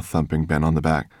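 usual speaking voice

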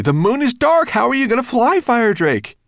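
high, loud panicked question; desperate cry. A narrator's reading. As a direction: plead at the top of your voice. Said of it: "cried Ben desperately".

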